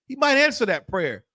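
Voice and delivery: high, rising sermon rebuke; preacher scolding the congregation with rising fire; fiery preaching. Acted. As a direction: hammer the sinners from the pulpit. Preaching at full force to congregation.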